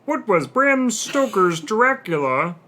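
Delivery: high, loud, slow quiz announcer. Acted. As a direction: boom it like a game show host. Reads quiz question in announcer voice.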